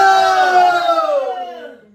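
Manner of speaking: long, high shout of prayer; crying out loudly. Shown as a demonstration. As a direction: hold the cry at full volume. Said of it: "이렇게 부르짖어야 돼".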